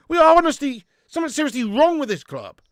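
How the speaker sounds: loud frustrated rant; angry rant. Real, not acted. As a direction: rant at the club. Ranting at club.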